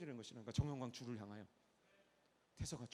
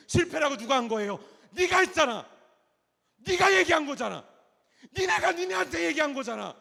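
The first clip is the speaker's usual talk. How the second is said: loud, high, fiery sermon cry; preaching at full force; 20 dB louder than usual. Acted. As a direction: thunder it at the congregation. Preaching God's words at full force. (목청 높여 외치는 설교)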